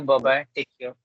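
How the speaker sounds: ordinary talk, nothing marked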